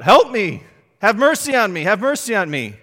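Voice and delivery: desperate loud pleading cry; crying out for help. Acted. Blind beggar crying out to Jesus, desperate shout. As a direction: shout out begging for mercy. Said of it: "he cries out".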